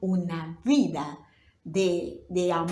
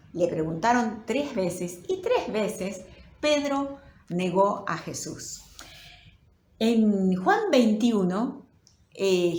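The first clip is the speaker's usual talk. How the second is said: normal talking voice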